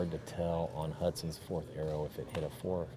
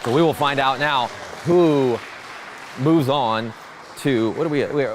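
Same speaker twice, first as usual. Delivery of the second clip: calling out; 8 semitones higher, 13 dB louder than usual. Real, not acted. Calling results out to the crowd.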